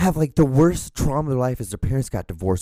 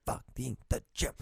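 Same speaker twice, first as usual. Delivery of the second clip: Fucking the gym said much softer, trailing off to himself; muttered under breath; 13 dB quieter than usual. Shown as a demonstration. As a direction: repeat Fucking the gym as a quiet aside.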